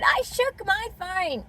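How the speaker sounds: high, drawn-out mocking quote; imitated shop girl. Mocking the shop girl's excuse, imitated voice. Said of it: "I should have said to the girl that said".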